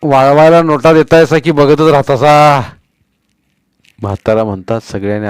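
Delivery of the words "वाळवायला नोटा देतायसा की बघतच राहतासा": put-on louder, rustic old man; impatient old man. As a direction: hurry the boys along. Old man's voice.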